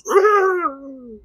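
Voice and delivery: high strangled yelp of pain; a sudden cry as the poker hits; pained yell. Retold or imitated. Retelling how he screams in pain, high strangled yelp. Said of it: "He goes".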